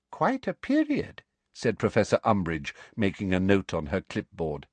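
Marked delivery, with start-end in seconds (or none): 0.1-1.2 high, slow, sugary official questioning; prim inquisitor voice. A narrator's reading. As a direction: ask slowly with a high prim voice.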